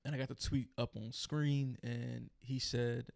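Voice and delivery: ordinary delivery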